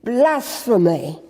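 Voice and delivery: drawn-out narrated shout. A narrator's reading.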